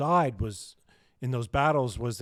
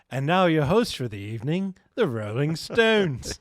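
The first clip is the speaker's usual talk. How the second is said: bad Liverpool accent; 3 dB louder than usual. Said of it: "Terrible Liverpool accent".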